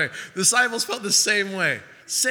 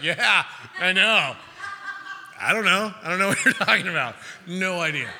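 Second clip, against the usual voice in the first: mock bluffing. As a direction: fake it through a laugh. Pretending to understand.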